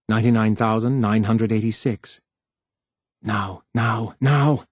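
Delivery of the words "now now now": usual speaking voice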